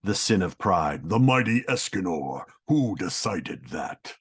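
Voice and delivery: low, slow, proud declaration; a god proclaiming his own might; Escanor voice. Acted. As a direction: boom it out like a sun-powered hero. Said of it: "he's powered by the sun".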